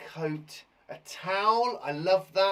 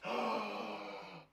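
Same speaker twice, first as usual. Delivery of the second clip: sharp surprised gasp; a theatrical intake of breath; mock gasp; 3 semitones higher, 8 dB quieter than usual. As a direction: gasp as if shocked. Reacting to riddle answer.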